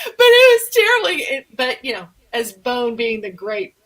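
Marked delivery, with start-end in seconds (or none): 0.0-1.1 high, loud whiny protest; mock whine, much higher and louder. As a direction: wail it like a hurt kid. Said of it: "I was like".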